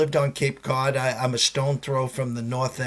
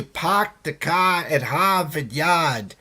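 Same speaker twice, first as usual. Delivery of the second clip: slow Boston accent, R's dropped; slower than usual. Shown as a demonstration. Host showing viewers his back-east speech, Boston accent. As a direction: draw out "pahk the cah" like a Bostonian. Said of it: "the R's don't come out".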